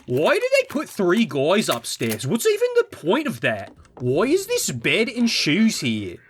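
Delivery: high, swooping whiny questions; mock baffled YouTuber. Mocking critics of the three guys upstairs, high baffled whine. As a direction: put on an exaggerated puzzled critic. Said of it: "when I talk like Half-Life two voice".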